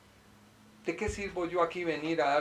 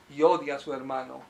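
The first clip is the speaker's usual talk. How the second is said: ordinary talk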